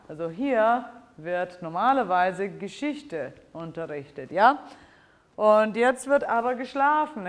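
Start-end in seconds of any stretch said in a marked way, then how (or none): 5.4-7.3 firm, higher and louder bedtime order; parent sending kids to bed. Shown as a demonstration. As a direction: tell the children it's bedtime, no arguing. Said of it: "I would say this to my children".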